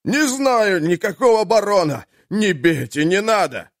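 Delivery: high terrified pleading, not full scream; mock wail of pain. A narrator's reading. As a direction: cry out in panic. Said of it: "Тот истошно завопил".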